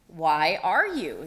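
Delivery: slow, patient repeat; the owl repeats, slowly; slow owl question. Acted. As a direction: ask it again, unhurried.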